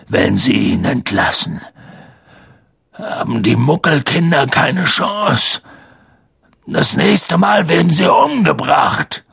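hoarse giant pleading, building to a cry; rough, agitated big-man voice; rough-voiced protest. A narrator's reading. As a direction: warn desperately, rising in pitch to the end. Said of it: "rief Hagrid".

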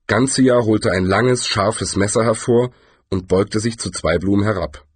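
ordinary delivery, nothing marked

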